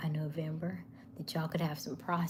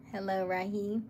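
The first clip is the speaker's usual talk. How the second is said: ordinary delivery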